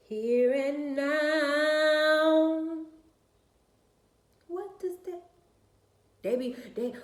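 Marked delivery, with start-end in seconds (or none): 0.1-5.2 loud, high, long-drawn last word; exasperated wail. Real, not acted. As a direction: stretch the final word into a rising holler. Exasperated rant reaching its climax.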